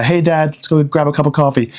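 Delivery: lightly voiced meek son; a hint of a pleading kid; son's coffee invite, mildly mimicked; timid son. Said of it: "I didn't get a".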